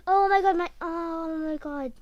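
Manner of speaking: loud start easing into a drawn-out coo; slowing, softening "oh my god"; real delighted surprise. Real, not acted. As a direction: react to something suddenly adorable. Reacting to dog making a noise.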